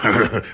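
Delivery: short, hearty laugh; jovial chuckle. Acted. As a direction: chuckle knowingly. First visitor grins before reassuring boss.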